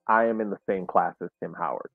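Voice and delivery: usual speaking voice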